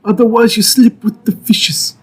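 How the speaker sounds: put-on menacing Godfather accent; friendly threat. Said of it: "the blend of Italian American and Christopher Walken".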